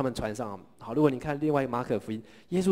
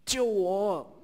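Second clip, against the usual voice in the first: higher cry of a sinking man begging to be saved; desperate higher shout for rescue; crying out for help; 7 semitones higher, much slower than usual. Acted. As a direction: call out in panic. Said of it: "他就大喊".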